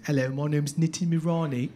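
low-pitched put-on British accent. Shown as a demonstration. Comic introduces himself to the audience, put-on British accent. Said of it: "but not there is the accent".

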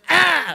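high loud "Ah!" burst; mock holy-spirit yelp; sudden cry. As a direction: let out a sharp high cry. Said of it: "and it's just".

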